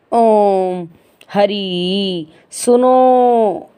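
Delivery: ओम, हरि, सुनो with the last sound held very long; prolonged calling vowels. Shown as a demonstration. Teacher showing how we call someone, ओम, हरि, सुनो stretched out. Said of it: "जब हम किसी को पुकारते हैं".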